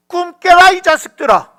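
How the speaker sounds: loud, high rebuke to the wicked; preacher's sharp outburst; scolding shout. Acted. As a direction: shout them awake from their dream.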